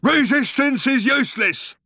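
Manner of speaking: high, loud drilled bellow; guard shouting. A narrator's reading. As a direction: roar the slogan mechanically. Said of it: "interrupted by the guard shouting again".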